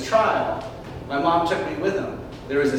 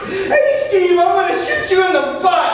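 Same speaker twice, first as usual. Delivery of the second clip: put-on much higher, loud, fast child taunt; kid shouting; 14 semitones higher, 8 dB louder than usual. Boy taunting big brother Steve, high loud shout. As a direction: shout it gleefully at your brother.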